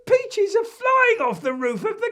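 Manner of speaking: put-on high, loud boy with a giggle; squealing excited child; calling out. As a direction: holler to Dad in giddy alarm. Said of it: "Joe is calling out".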